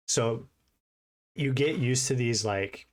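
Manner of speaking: normal talking voice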